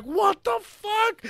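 very high, drawn-out mock yell; steaming-mad sergeant. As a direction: explode in slow, loud disbelief. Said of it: "literally see steam blowing out his ears".

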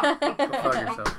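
slow, drawn-out playful insult; mock insult. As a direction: drag it out, teasing. Mock insult, then "I'm kidding.

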